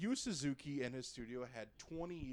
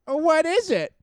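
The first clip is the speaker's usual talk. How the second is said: much higher, louder villager; 13 semitones higher, 17 dB louder than usual. Retold or imitated. Gamer plays a startled villager, high and loud. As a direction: squeak it like a startled townsperson. Villager NPC in retold game.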